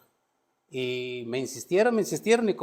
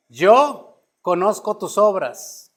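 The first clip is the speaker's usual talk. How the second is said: louder, slow, solemn proclamation; scripture read as God's voice; 5 semitones higher, 7 dB louder, slower than usual. Acted. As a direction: declare it as the Lord speaking to the church. Reads God's words to the church.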